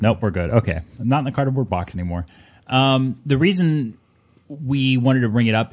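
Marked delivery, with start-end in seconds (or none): none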